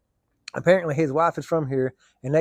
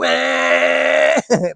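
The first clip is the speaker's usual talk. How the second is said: engine backfire noise; 7 dB louder than usual. Retold or imitated. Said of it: "Sometimes it'd go".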